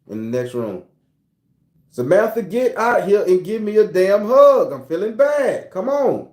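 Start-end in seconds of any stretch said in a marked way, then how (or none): 1.9-6.3 loud, higher, drawn-out holler; insistent raised-voice summons; calling out demandingly. Acted. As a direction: holler slowly for someone in another room. Acts man calling wife for hug.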